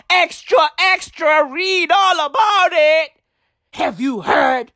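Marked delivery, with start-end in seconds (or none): 0.1-3.1 high-pitched street-hawker call; newsboy cry. Acted. Ranter hawking the rumour like headlines, newsboy cry. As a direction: call it out high like a paper seller.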